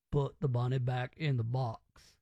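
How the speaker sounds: low, slow-witted mock Southern drawl; Nick Cage. Host mocking Con Air, slow-witted Southern impression. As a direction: play it a bit slow, pitched well down. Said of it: "played it like he was a bit slow".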